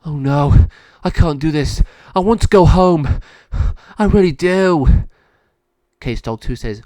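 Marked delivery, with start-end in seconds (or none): 0.0-4.9 loud, high distressed cry; pleading at full voice, much higher; panicked dog. Acted. Monty panics at the news.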